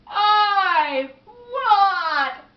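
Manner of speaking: huge, high, drawn-out wail; very loud howl of frustration; desperate shout. Acted. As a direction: bellow each word, holding the last one long. Man at end of his patience.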